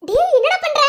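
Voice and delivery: high, loud startled cry; half-laughing protest, put me down; wife shrieking at husband who lifts her up. Acted. Wife protesting being lifted up.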